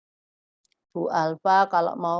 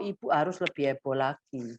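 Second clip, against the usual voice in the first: ordinary talk, nothing marked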